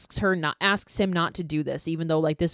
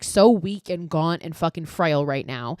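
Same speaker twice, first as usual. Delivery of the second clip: ordinary delivery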